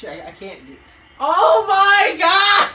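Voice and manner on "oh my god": much higher, much louder slow cry; shocked scream. Real, not acted. Gamer losing it at a wild play, slow shocked scream. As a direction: scream it out in disbelief.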